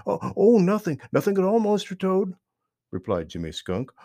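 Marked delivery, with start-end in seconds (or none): 0.1-2.3 fast, higher, flustered denial; hasty. Acted. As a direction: brush it off quickly. Jimmy hastily covers himself.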